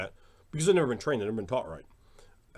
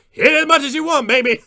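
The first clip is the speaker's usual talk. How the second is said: loud, high-pitched holler; gleeful shouted challenge; defiant taunt; 15 dB louder than usual. Real, not acted. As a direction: dare the haters at full volume. Taunts viewers hitting dislike.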